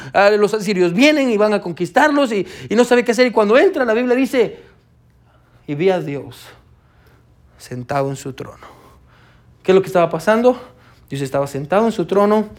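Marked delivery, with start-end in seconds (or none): 5.7-8.7 quiet reverent reading. Acted. Pastor reads verse with awe.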